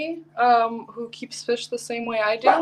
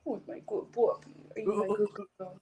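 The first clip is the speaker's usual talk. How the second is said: talking to a dog; 4 dB quieter than usual. Real, not acted. Cooing at her pug.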